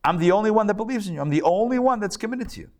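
high, fast exasperated complaint; frustrated prophet. Retold or imitated. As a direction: vent to God that no one else believes. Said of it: "he says to God".

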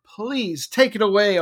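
ordinary talk, nothing marked

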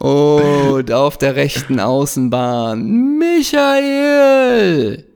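drawn-out stadium announcer call. Pretend. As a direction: call the player's first name like a stadium announcer. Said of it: "im Stadionsprechermanier".

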